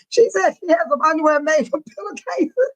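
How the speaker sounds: normal talking voice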